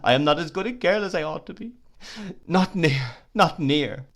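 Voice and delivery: high, loud, fast sobbing words; heartbroken crying. Acted. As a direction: blurt it out as the heart breaks. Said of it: "she still cried as if her heart would break".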